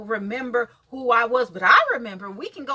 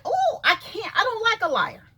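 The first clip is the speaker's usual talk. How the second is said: 1 retold retort; 8 semitones higher than usual. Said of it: "but I was like".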